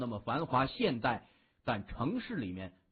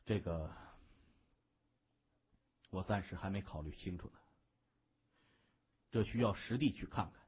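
a touch lower and quieter, considering; thoughtful boss, lightly played in a lower voice; lightly voiced gang boss; 7 semitones lower, 6 dB quieter, faster than usual. A narrator's reading. Narrator voices gang boss replying. (略微压低声音，沉吟着说的帮派大哥)

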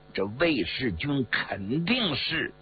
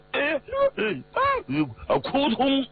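strangled, high-pitched gagging; acted seizure noises; high choking gasps; 12 semitones higher, slower than usual. A narrator's reading. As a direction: choke out broken grunts as you collapse. Character chokes before falling.

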